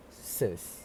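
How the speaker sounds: "sis" as a low, soft "suhs"; schwa syllable. Shown as a demonstration. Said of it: "a schwa sound".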